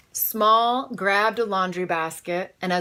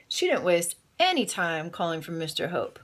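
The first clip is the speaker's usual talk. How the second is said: ordinary delivery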